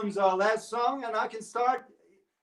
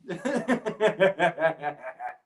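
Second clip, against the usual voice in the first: long run of chuckles; real laughter; 6 semitones lower than usual. Real, not acted. Performer amused by his own Jägermeister joke, laughing.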